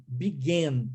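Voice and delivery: "Began" with the final AN said like "en"; began ending in "en". Shown as a demonstration. Teacher showing the past of begin, "Began" ending like "en". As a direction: stress the last syllable of "Began", sounding "bi-GEN". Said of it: "pronuncia-se N".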